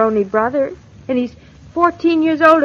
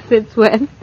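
laugh, then a teasing question; amused, disbelieving chuckle; 2 semitones lower than usual. Acted. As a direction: tease him through a little laugh. Teasing husband about being bashful.

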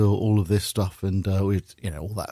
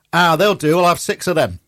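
put-on higher, louder West Country accent; 6 semitones higher, 4 dB louder than usual. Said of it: "that's how the Wurzels speak".

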